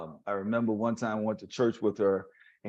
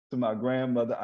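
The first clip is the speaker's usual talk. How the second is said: usual speaking voice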